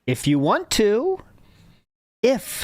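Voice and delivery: "if" set apart as the key word; stress on IF. Shown as a demonstration. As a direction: say the phrase, pause, then a pointed lone "if". Repeats the key word to correct.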